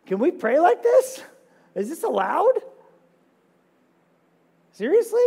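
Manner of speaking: high, loud disbelieving questions; mock shock at the psalm; wide-eyed astonishment; incredulous. Real, not acted.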